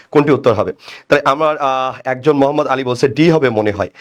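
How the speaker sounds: normal talking voice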